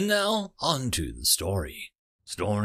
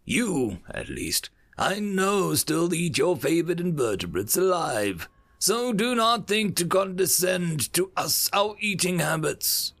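contemptual accusation; 7 semitones higher than usual. Acted. Envoy accusing the bird ambassador.

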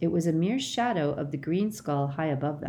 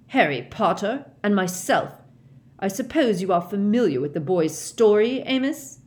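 stern; 5 semitones higher, 6 dB louder than usual. A narrator's reading. Crouch rebuking Diggory continues.

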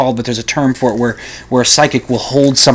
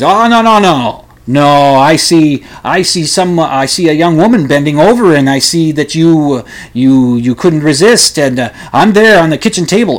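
higher, louder eccentric psychic; hammed-up psychic spilling a secret vision; showy psychic, higher and louder, slowing on the reveal; mock psychic, insistent; 3 semitones higher, 5 dB louder than usual. Psychic exposes the detective.

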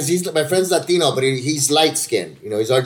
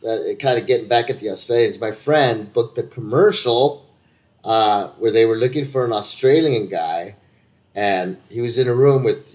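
ordinary delivery

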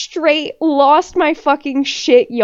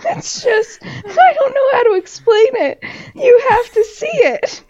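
laughing through words; 10 semitones higher than usual. Real, not acted. Laughing while describing the face.

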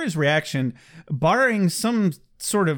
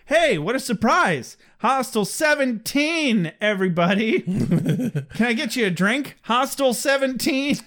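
higher, slow hammed-up bragging; showboating Xander; 8 semitones higher than usual. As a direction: show off to the room, laughing through it. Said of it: "'Cause Xander's like".

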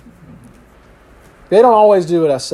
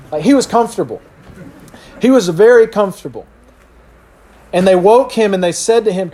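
ordinary talk

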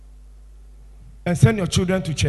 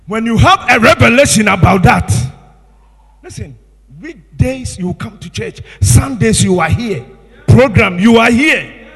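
louder, driving exhortation; forceful preaching; 2 semitones higher, 10 dB louder than usual. Real, not acted. Preacher urging congregation to attend, loud exhortation. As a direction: press the church to come every day.

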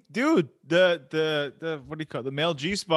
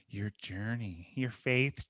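self-consciously cheesy; 7 semitones lower, 9 dB quieter, slower than usual. Real, not acted. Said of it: "oh, this sounds so lame".

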